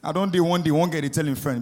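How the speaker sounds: ordinary delivery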